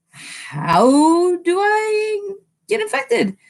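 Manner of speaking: high, drawn-out rhetorical question; exasperated question. Real, not acted. Streamer arguing that isolation works, exasperated. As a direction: stretch it out high in disbelief.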